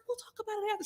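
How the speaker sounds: high, hushed little voice; mock evasive Melissa. Melissa putting Teresa off, high hushed mock voice. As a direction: mimic someone softly dodging. Acting Melissa evading the talk.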